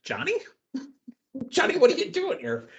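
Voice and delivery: high, teasing call of Johnny's name, with a laugh; mock Theory voice. Podcaster imitating Theory greeting Johnny, high and mocking. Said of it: "and he goes".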